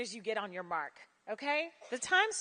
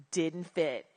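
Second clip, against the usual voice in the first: low, flat letdown after a sigh; deflated; 6 semitones lower than usual. Shown as a demonstration. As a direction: drop it low and let it sink. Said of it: "Use your breath for your dramatic pause".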